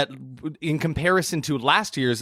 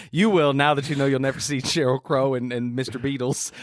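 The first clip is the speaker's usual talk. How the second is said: ordinary talk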